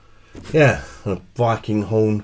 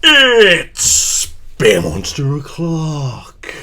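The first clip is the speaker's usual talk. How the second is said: high, loud, drawn-out show intro; catchphrase announcement; 8 semitones higher, 6 dB louder, much slower than usual. Acted. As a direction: stretch it out like a big rowdy announcer.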